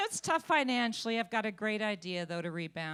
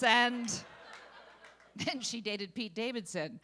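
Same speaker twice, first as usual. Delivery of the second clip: ordinary delivery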